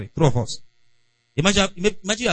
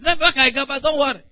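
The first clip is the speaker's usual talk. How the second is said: mock high voice; 10 semitones higher than usual. Retold quarrel, high voice.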